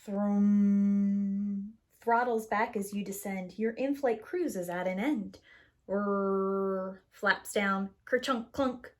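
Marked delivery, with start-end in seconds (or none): 0.0-1.7 engine thrum. Pretend. Throttles back on descent, droning thrum. 5.9-7.0 droning whirr; flaps whirr. Pretend. Flaps go down for landing, droning whirr.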